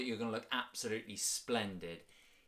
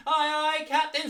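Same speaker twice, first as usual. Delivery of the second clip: high, loud sailor's salute; 11 dB louder than usual. Shown as a demonstration. Teacher playing a sailor answering the captain, high and loud. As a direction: shout it like an eager deckhand.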